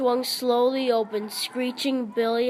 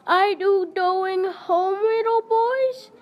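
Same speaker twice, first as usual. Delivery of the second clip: much higher put-on girlish voice; shrill girlish voice; 9 semitones higher, 3 dB louder than usual. Billy calling after the fleeing boys in a girlish voice. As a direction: tease the boys as a silly girl. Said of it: "yelled Billy".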